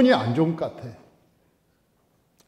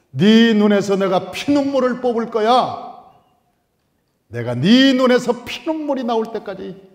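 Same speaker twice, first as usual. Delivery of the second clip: mock vengeful vow; 5 semitones higher, 6 dB louder than usual. Father's vengeful inner vow.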